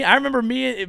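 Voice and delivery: ordinary talk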